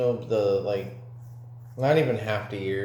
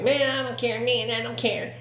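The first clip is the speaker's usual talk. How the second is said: high-pitched mocking kid voice; squeaked-up imitation of a careless kid; little kid; 12 semitones higher than usual. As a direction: mimic a small boy way up high. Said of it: "he was like, a little jit".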